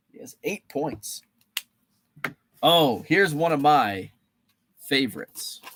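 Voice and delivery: normal talking voice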